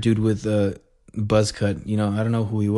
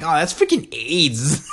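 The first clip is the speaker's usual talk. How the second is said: mock-annoyed, drawn-out gripe, pitched up; slow, higher, hammed-up annoyance; pissed-off gamer; 4 semitones higher, slower than usual. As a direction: stretch it out in mock exasperation. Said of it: "I get pissed off".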